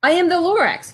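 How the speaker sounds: high, pinched little creature voice; Lorax voice. Acted. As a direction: announce yourself as a fussy forest creature. Lorax introduces himself.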